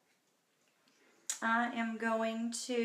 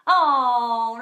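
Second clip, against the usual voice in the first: loud, drawn-out oh; reading a viewer's kind comment, touched and surprised; touched surprise; 12 dB louder than usual. Real, not acted. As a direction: caught off guard by a kind comment.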